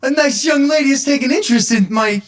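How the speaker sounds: very high put-on voice; hammed-up delight at being asked; mock flattered writer. Plays himself flattered, pretentious writer.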